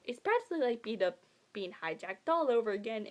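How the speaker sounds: ordinary talk, nothing marked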